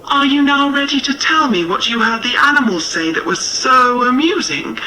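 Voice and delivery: lightly voiced impatient wife; wife calling out. A narrator's reading. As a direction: call through the closed door. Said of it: "His wife's voice called out from within".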